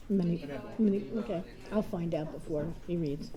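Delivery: ordinary delivery, nothing marked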